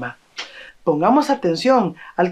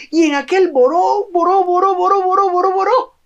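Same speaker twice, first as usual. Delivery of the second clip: chaotic fussing; 12 semitones higher than usual. Acted. Chaotic bustle acted out.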